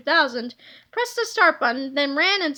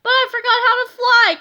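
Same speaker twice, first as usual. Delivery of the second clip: higher, louder sheepish confession; pitched-up sheepish whine; sheepishly; 8 semitones higher, 7 dB louder than usual. A narrator's reading. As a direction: admit it embarrassed. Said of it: "Captain Underpants said sheepishly".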